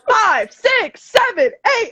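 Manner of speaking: loud high mock count-in. Dance teacher calling the count-in to the class, loud and high.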